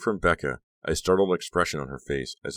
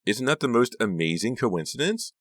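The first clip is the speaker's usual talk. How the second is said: higher-pitched gushing amazement; 7 semitones higher than usual. A narrator's reading. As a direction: marvel at it, gushing. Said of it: "the girl gushed".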